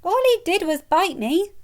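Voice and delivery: high and loud, rushed out; blurted. Acted. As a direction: blurt it to calm her. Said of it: "Ron blurted out".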